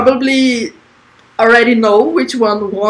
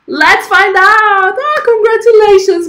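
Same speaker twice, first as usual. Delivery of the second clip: excited winner reveal; 10 semitones higher, 4 dB louder than usual. Real, not acted. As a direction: draw out the winner's name. Announcing award winner dramatically.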